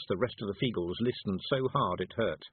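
usual speaking voice

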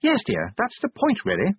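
high-pitched wife, dropping on "dear"; high, matter-of-fact wife voice; wifely correction. Acted. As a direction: correct your husband lightly, pitch falling at the end. Sybil answers her husband.

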